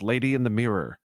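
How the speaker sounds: loud, frantic kenku cry. Acted. Kenku pointing at the mirror for help, frantic.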